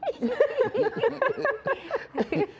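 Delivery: a real laugh that leaps up high; high burst of laughter. Real, not acted. Comedian cracks up at his own cameraman joke, high laugh.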